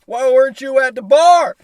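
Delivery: loud, high, drawn-out holler; mock shouted question. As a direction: yell it across to a passing buddy. Imagined shouted exchange in passing.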